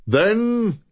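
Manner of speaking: high booming call. Acted. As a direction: boom it out decisively. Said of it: "boomed Luthien Lim decisively".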